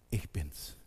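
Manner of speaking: low, soft, solemn; quiet, intimate divine voice; hushed words of Jesus. Acted. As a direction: speak it gently into the silence.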